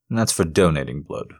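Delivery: usual speaking voice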